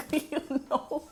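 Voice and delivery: high, giggly aside; laughing. Real, not acted. Laughs after joking about heat.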